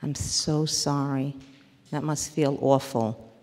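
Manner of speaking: normal talking voice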